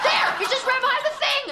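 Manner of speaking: high-pitched urgent alert; alarmed. Acted. Woman warning roommate of running mouse, alarmed. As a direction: cry out the sighting in alarm.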